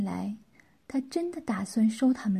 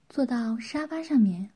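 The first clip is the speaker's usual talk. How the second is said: ordinary delivery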